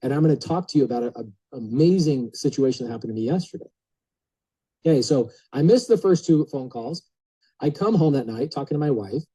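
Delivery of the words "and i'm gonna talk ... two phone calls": ordinary talk, nothing marked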